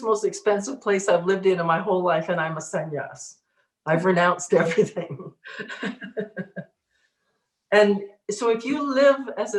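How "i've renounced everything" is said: laughing irony. Real, not acted. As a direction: say it through a laugh. Jokes about renouncing in luxury.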